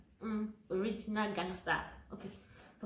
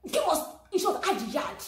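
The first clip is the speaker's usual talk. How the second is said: mock demand; 8 semitones higher, 6 dB louder than usual. Demanding more at the market.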